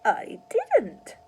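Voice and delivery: put-on high old-crone crackle; crackling old woman. A narrator's reading. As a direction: crackle it smugly. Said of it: "crackled the old woman".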